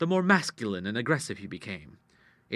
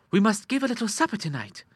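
put-on higher, confident girlish voice; scheming sister Ida; 10 semitones higher than usual. Girl explaining her plan to Clara, high and confident. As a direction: lay out the plot brightly.

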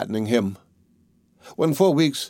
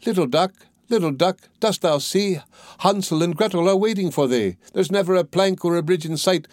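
high, lilting rhyme call to a duck; storybook rhyme, chanted high; girl calling out; 6 semitones higher than usual. A narrator's reading. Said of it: "and then she cried".

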